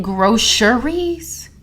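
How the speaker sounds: "groceries" drawn out as GRO-sher-eez. Retold or imitated. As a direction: say "groceries" slowly with a "sh" in the middle. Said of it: "It's groceries".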